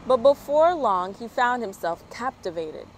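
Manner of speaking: lively storybook reading voice; bright, animated picture-book narration; reading to children. A narrator's reading. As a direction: read it out like story time for little ones. Reads a children's story aloud.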